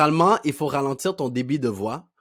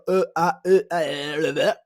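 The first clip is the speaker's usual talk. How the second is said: louder string of stammered filler vowels; hesitation noises; 2 semitones higher, 6 dB louder than usual. Shown as a demonstration. Coach mimics filler sounds to replace, exaggerated stammer. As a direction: stall noisily hunting for a word. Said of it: "à la place de dire".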